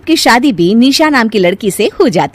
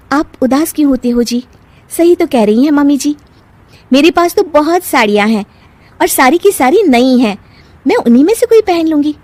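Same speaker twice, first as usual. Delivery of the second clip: higher-pitched, consoling wife; reassuring young wife; 7 semitones higher than usual. Acted. Wife consoling husband over saris, higher-pitched and reassuring. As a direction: cheer a downcast husband up.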